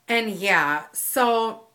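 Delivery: ordinary talk, nothing marked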